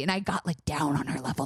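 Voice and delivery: ordinary delivery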